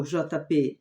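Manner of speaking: ordinary talk, nothing marked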